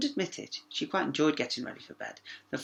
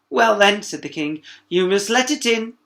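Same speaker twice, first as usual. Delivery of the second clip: king voice; 7 semitones higher, 11 dB louder than usual. Acted. King decides.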